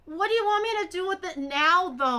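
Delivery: loud, high-pitched complaint; exasperated. Real, not acted. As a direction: demand an explanation, high and loud. Exasperated at being told now.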